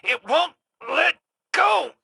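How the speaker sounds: high, frantic cry for rescue; panicked plea. Acted. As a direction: shout for help as it clings on. Trapped in a nightmare vision.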